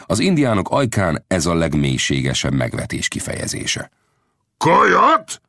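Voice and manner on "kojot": high, loud rising question; outraged roar. A narrator's reading. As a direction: yell the insult back in disbelief. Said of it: "ordította a bandita".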